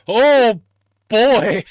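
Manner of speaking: high, loud, separated words; loud exclamation. Real, not acted. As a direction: belt out each word in reaction. Reacting to the big star's name.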